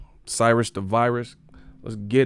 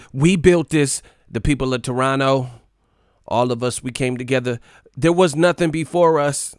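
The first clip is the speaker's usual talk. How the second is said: over-hyped mock victory speech, louder and higher; 4 semitones higher, 5 dB louder than usual. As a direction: proclaim it like a carried-away star. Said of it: "[name] was acting a fool".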